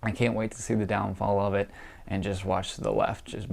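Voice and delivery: ordinary talk, nothing marked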